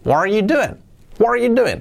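lively joined "What are you doing?"; intonation without hey. Shown as a demonstration. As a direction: link "What are" and arc up then down on "doing". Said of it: "Quitando el hey, sería".

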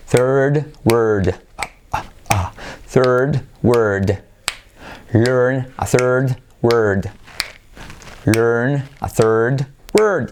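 "Learn a third word" said very slowly; slow drill. Shown as a demonstration. Teacher drilling the sentence slowly from the end. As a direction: stretch each word like a pronunciation drill. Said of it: "Vamos a practicar desde abajo".

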